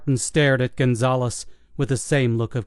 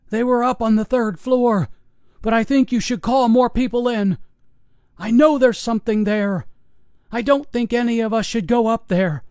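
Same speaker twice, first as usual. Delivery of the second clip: high, anxious urging; scared old guard; 10 semitones higher than usual. Acted. Old guard warning the investigators not to go upstairs, scared. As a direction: warn them off, frightened.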